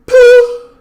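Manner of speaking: high explosive poo sound; short sharp poo. Shown as a demonstration. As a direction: punch out a quick poo. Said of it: "doing poo".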